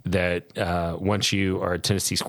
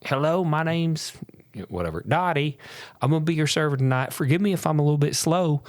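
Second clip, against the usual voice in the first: waitress voice; 7 semitones higher than usual. Retold or imitated. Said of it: "our waitress walks up and she goes".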